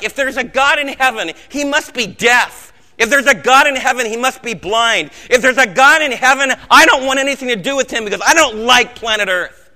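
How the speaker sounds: loud, high, bitter cry against God; anguished protest. Retold or imitated. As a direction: cry out the soldier's doubt with rising force. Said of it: "you started to feel like".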